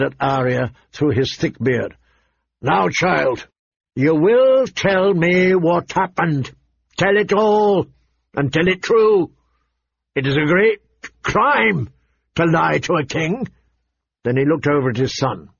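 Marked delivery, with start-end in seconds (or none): 2.6-13.5 stern, raised-pitch royal command; weighty, drawn-out orders to a child; irritated king. Acted. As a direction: lay down the law as an irritated king. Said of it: "his voice thick with irritation".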